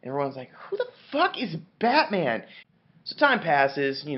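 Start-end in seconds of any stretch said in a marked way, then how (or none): 0.7-2.4 high-pitched incredulous question; hammed-up disbelief, pitched way up; mock bafflement. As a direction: squawk it as a baffled crowd. Acted baffled crowd reply.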